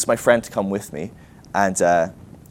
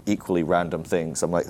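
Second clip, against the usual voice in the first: ordinary delivery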